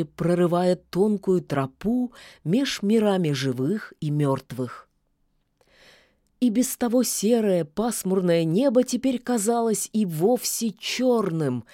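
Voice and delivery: usual speaking voice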